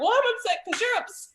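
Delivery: very high, exasperated comeback; upset mom. Retold or imitated. Mother echoing her upset daughter, exasperated. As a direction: throw it straight back, voice way up. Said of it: "I'm frustrated".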